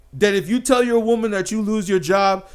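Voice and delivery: usual speaking voice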